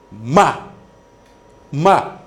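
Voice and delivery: "Ma" short, starting high and falling sharply; fourth tone. Shown as a demonstration. Teacher demonstrates the last tone, falling "Ma". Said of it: "le dernier ton".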